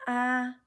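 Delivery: high level "Ah"; first tone. Shown as a demonstration. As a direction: keep "Ah" high and flat. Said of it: "the first tone is high and flat".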